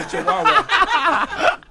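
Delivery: slow laughing tease. Real, not acted. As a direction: crack up mid-line. Mocking laughing comparison.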